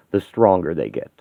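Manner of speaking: ordinary talk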